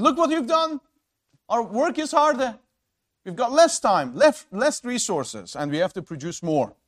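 high, loud grievance easing toward the end; exasperated acted protest, settling by the last words; accusing complaint. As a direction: throw the blame at Moses, starting high. Acted complaint of Israelites to Moses.

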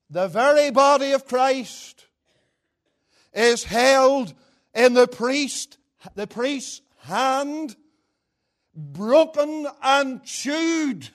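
slow, high, loud pulpit declamation; full-force preaching. Real, not acted. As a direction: draw out each phrase of the quotation. Declaims a quotation to congregation.

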